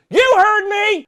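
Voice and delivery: very high, very loud mock bully shriek; scary kid's yell. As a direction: scream it as the menacing kid. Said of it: "scary kid comes up to me".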